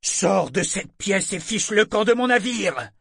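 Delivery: loud, high furious order; thundering anger. A narrator's reading. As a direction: throw them out at full voice. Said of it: "gronda le commandant". (ordre furieux, en grondant)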